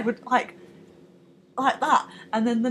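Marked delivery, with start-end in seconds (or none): none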